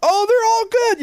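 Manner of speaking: high, loud cheery mock doctor. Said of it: "So, like".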